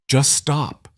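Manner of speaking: a mild tremble on one word; voice shaking. Acted. Sister stopping the threats against her brother, shaking. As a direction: cut him off, lightly shaken. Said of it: "Vivian's voice shook".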